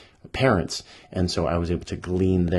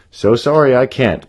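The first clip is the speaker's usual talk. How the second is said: hasty loud apology; 6 semitones higher, 9 dB louder than usual. Acted. Badger flees.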